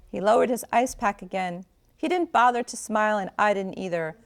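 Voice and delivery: usual speaking voice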